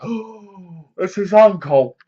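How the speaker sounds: a gasped "oh", then a sudden loud "it's his uncle"; mock shock. Viewer reacting to the twist while watching, in mock shock. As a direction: play the stunned viewer who just got it.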